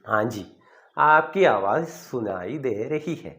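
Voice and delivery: each Hindi word spaced out slowly; teacher spelling out ആപ്കി Awas സുനായി ദേ രഹി ഹെ slowly for students; slow teaching demo. Shown as a demonstration. Teacher slowly models the Hindi phone phrase for learners. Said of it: "അപ്പൊ എങ്ങനെ പറയും?".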